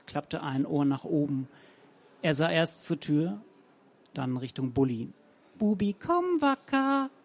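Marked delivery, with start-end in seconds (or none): 5.6-7.1 much higher, slow calling voice; Zenker impression calling. A narrator's reading. Romanow calls the poodle in Frau Zenker's voice, high. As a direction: call the dog as its owner. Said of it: "rief Romanow".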